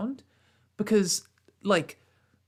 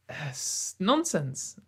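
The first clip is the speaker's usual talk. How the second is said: slow dismissal; 3 semitones lower, much slower than usual. Real, not acted. Dismissive verdict.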